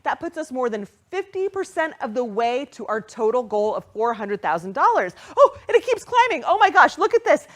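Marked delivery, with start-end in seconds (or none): 5.4-7.5 high, loud and thrilled; can't contain the joy; excited. Real, not acted. Host watching donations climb, excited.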